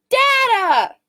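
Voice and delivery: very high, loud, drawn-out shout; joint shout. Acted.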